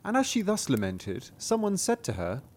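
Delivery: ordinary delivery, nothing marked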